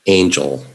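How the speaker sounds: Angel said clearly as AYN-jel; model pronunciation. Shown as a demonstration. Teacher models Angel for the class, carefully. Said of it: "Not angle".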